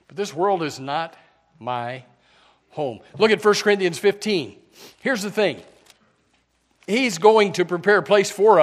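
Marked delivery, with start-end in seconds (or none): 0.1-3.0 soft and slow, word by word with pauses; hushed, drawn out. Acted. Pastor hushes the congregation: this world is not my home, soft and slow. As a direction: drop the voice and space out each word. Pastor slows on key phrase.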